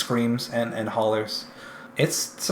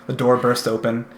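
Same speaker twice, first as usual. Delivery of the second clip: usual speaking voice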